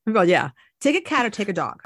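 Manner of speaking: ordinary talk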